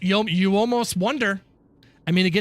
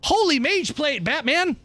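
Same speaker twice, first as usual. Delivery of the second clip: excited exclamation; 8 semitones higher, slower than usual. Real, not acted. Streamer thrilled at the loot drop, excited. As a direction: blurt it out like Robin.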